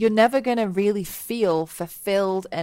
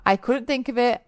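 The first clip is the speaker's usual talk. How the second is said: ordinary talk, nothing marked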